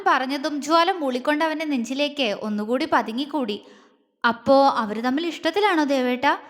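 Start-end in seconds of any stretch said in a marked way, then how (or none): none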